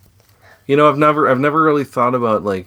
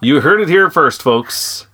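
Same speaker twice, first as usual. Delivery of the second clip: mock announcer; 6 semitones higher, 5 dB louder, slower than usual.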